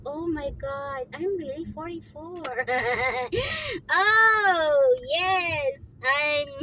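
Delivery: high, drawn-out cry of joy through laughter; laughing, whooping relief at the discovery; delighted realization. Real, not acted. Woman finds she is really forty-four, delighted.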